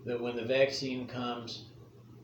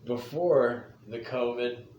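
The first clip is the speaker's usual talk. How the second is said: storyteller voice. Acted. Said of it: "Gather around, kids".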